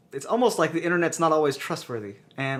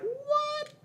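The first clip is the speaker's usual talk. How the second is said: high squeaky disbelieving what; shocked what; 6 dB quieter than usual. Retold or imitated. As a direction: pop up high in amazed disbelief. Reaction on seeing own drawing.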